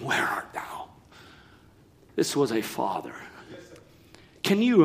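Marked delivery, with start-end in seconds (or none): 0.0-0.9 soft, breathless whisper of God calling; hushed divine call. A narrator's reading. Preacher reading God calling Adam, whispered. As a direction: breathe the question softly into the garden. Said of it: "the Lord called unto Adam and said unto him".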